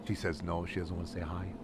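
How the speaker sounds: soft cooing to the cat. Real, not acted. Streamer coos softly to his cat, tender baby talk. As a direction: coo gently at the pet.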